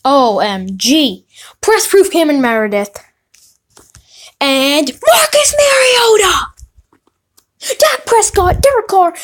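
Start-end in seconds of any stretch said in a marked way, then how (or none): 4.4-6.5 excited shout. Real, not acted. Shouts name at big pull. 7.6-9.1 excited listing. Real, not acted.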